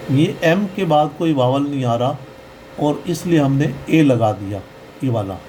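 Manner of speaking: usual speaking voice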